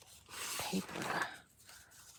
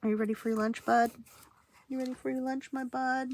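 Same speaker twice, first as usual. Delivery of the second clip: pet talk; 4 semitones higher, 5 dB louder than usual. Real, not acted. Asking cat about lunch.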